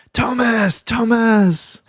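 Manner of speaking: high calling shout down the hallway; yelling a name. Retold or imitated. Boss yelling for the teacher down the hall, high call. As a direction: call his name like an angry boss hunting him. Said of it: "I could hear her literally yelling".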